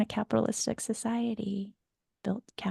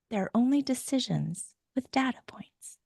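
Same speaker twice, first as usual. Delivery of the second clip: soft whispered comfort; hushed reassuring whisper; whispered reassurance; 5 semitones higher, 3 dB quieter than usual. Retold or imitated. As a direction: whisper it like a secret to a child. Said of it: "your functional adult ... goes".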